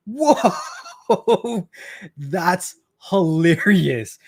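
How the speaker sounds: drawn-out high "whoa", laughing into very slow words; retold laughing disbelief, stretched out slowly; amused amazement. Dad reacting to his son's phone excuse, laughing in amazement.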